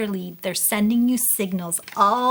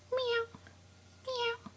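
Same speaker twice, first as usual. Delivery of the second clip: tiny, high, soft mews; cat meow; 12 semitones higher, 11 dB quieter, slower than usual. Pretend. Counting Chloe Cats with a child, high soft meows. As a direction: mew softly like a little cat. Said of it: "Let's try and say meow".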